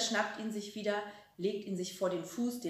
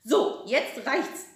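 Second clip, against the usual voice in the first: angry; 5 semitones higher, 8 dB louder, much slower than usual. Acted. Grandma bursts in angrily.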